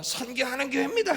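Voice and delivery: high-pitched mock slogan call; imitated slogan shout. Preacher imitating a pastor's mission slogan, high-pitched shout. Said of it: "하고 소리를 지르니까".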